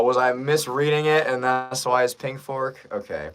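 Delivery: ordinary delivery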